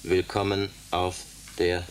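slow, soft, word by word as if tapping it out; repeating a message to send by lamp, slow and quiet; slow spelled-out signalling. Acted. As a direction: spell it out slowly while signalling. Repeating message while morsing it.

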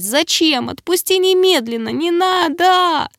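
high-pitched, wailing protest; panicked plea. A narrator's reading. Girl begs creature to release her arm, panicked. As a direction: beg in a high cry, stretching the end.

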